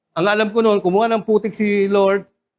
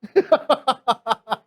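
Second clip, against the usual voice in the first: light laugh; 4 semitones higher than usual. Real, not acted. Laughs after cockroach demo.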